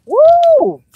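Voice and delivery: a sudden, very high, loud woo; excited whoop. Real, not acted. Greeting her viewers with a sudden excited whoop. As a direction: let out a real burst of excitement. Reacting outdoors on arrival.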